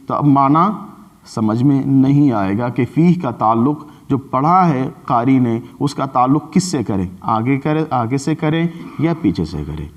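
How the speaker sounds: usual speaking voice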